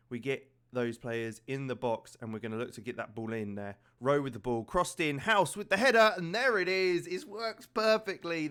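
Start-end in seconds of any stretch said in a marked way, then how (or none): 4.6-8.5 high, loud goal celebration; excited goal call. Acted. Goal scored in commentary.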